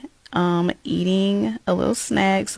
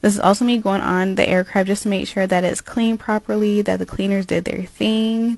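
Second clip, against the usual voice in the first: normal talking voice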